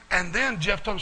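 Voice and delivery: ordinary talk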